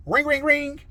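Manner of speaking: high, loud sing-out ring; imitated phone ringing. Phone rings as he leaves car, high imitated ringing.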